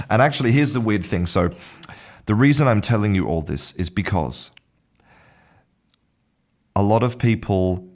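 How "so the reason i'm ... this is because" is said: ordinary talk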